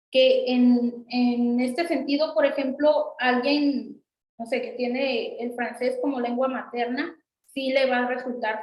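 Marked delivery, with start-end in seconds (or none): none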